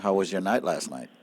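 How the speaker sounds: low, soft seductive voice; sexy voice. Real, not acted. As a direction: purr it low and soft like a late-night DJ. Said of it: "the sexiest voice when you spoke at first".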